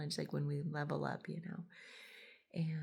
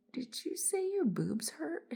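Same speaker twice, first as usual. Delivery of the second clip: incredulous high question; 11 semitones higher, slower than usual. Retold or imitated. Confused woman mishearing.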